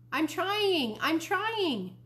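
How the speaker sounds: put-on high-pitched kid's holler. A narrator's reading. Fudge answering his brother through the wall, hollering. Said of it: "Fudge hollered".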